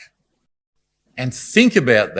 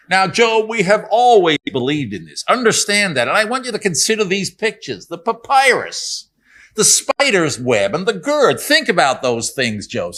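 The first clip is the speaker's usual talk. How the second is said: put-on higher, eager young American accent; younger American guy; 6 semitones higher than usual. Preacher voicing Bildad as a young American, pitched up and earnest. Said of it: "the younger American guy".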